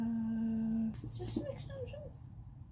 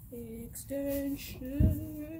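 very drawn out; 4 dB quieter, much slower than usual. Shown as a demonstration.

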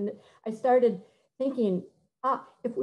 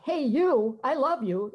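brash American English; 4 semitones higher, slower than usual. Shown as a demonstration. Speaker to choir teachers, demoing brash American English singing diction. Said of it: "heard it sung in brash American English".

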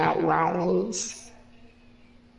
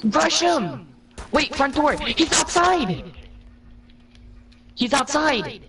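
urgent shouting; 6 semitones higher, slower than usual. Real, not acted. Urgent callouts in the game.

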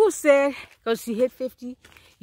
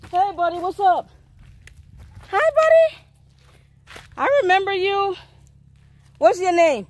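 high-pitched, louder sing-out to a little buddy; sweet-talk greeting; 9 semitones higher, 4 dB louder than usual. Real, not acted. Woman greets a new buddy on the walk, high-pitched sweet talk. Greeting someone's dog or child.

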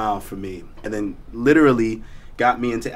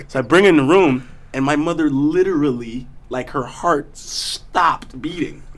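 ordinary talk

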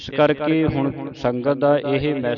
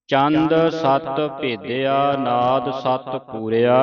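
slow, measured recitation running on to a stop at ਪੂਰਿਆ; pause after ਪੂਰਿਆ; slower than usual. Shown as a demonstration. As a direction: recite deliberately, resting only after ਪੂਰਿਆ. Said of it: "ਪੂਰਿਆ ਤੇ ਆਣ ਕੇ ਰੁਕਣਾ ਹੈ".

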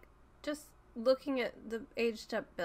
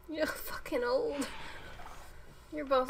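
ordinary talk

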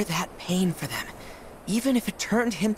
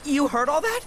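surprised; 8 semitones higher, 4 dB louder than usual. Acted. Caught off guard by eavesdropper.

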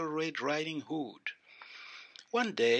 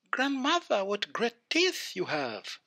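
put-on wondering little girl; 8 semitones higher than usual. A narrator's reading. Girl wonders at changed grandmother.

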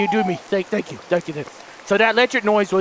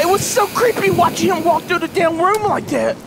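ordinary talk

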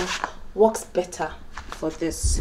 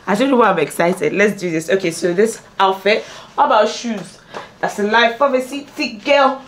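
ordinary talk, nothing marked

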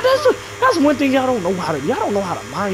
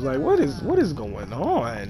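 much softer, slow, puzzled; baffled mutter; 8 semitones lower, 9 dB quieter, slower than usual. Real, not acted. A streamer quietly baffled by strangers. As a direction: let it fall away softly.

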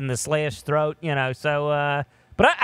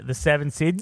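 ordinary delivery

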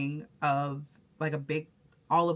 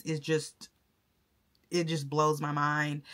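ordinary talk

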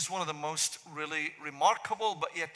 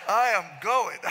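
laughed through, louder; mock exit; 5 semitones higher, 9 dB louder than usual. Preacher joking to the church that he is leaving, laughing through it. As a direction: pretend to leave, laughing.

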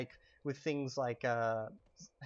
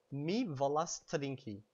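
slow careful model answer; slower than usual. Shown as a demonstration. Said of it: "how would you say, "I want to drink"?".

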